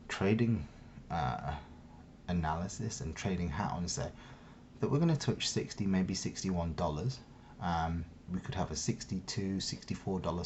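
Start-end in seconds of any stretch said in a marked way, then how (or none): none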